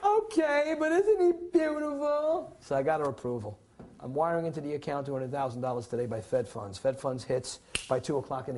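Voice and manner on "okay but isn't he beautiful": high, loud, slow gushing wife voice with a teary crack; hammed-up wife fawning over the baby in a high voice. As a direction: coo over the newborn like a tearful new mother. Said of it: "that's when she said".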